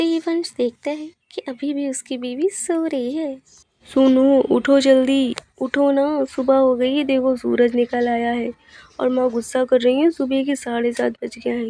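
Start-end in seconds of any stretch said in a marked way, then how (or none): 3.9-11.7 loud, insistent husband voice; urgent wake-up call. Acted. Husband waking his sleeping wife before mother gets angrier, urgent. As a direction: rouse a sleeper with raised voice.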